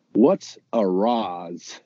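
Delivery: slow, drawn-out Anglo "arroz" as "uh-ROZZ"; mock American mangling "arroz"; bad gringo Spanish. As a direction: sound out "arroz" like a clueless American reading the menu. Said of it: "he's like".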